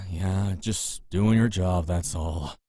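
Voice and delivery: calm the guard down gently; soft slow reassuring placation; placating. Acted.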